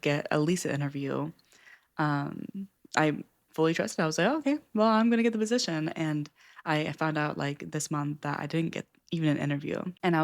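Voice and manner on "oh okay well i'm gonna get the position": bright, higher and louder, sure of herself; overconfident certainty. Retold or imitated. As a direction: replay your naive total certainty, louder. Recalls her confident expectation.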